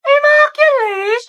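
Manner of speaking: high, slow mocking repeat; mock shocked question; mocking echo. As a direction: throw the word back in disbelief. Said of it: "Para naman kayo mga sira".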